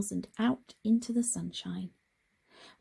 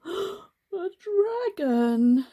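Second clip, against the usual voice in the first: a high gasp, then a loud burst; gasping fright; 10 semitones higher, 7 dB louder, much slower than usual. Acted. Frog scaring himself, gasping then crying out. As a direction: gasp and blurt it out in terror.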